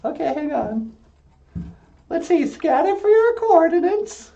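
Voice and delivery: high-pitched puppet voice; high puppet chatter; puppet character. Acted. Puppet operates pretend teleporter.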